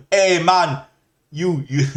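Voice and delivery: put-on high, loud slipped-in Jamaican accent, quickly abandoned; a sudden hammed-up Jamaican accent, then catches himself; mock Jamaican accent. Streamer slips into the wrong mock Jamaican accent. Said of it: "that's a Jamaican accent".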